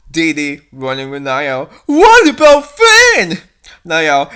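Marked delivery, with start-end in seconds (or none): 1.9-3.4 high, loud, slow anxious question; worried shout. Acted. Fleeing princess shouts about her brother.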